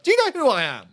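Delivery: high, loud mock outrage; belligerent entitlement. To the guard at the tent door, hammed-up outrage. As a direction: puff up like an offended VIP. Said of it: "I was being so belligerent at the door".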